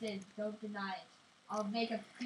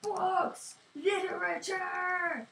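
dramatic reveal; 6 semitones higher, 5 dB louder, much slower than usual. Pretend. Dramatic announcement.

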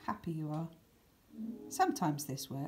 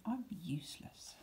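much softer, small and defeated; quiet despair; 7 dB quieter than usual. Pretend. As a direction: let it fall away to nothing. Sad thought quoted.

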